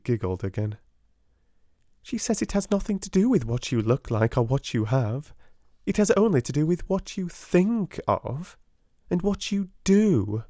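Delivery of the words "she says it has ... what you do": put-on high girlish schoolgirl voice; teasing Jessie. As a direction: play the gossipy younger girl.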